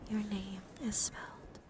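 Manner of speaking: ordinary delivery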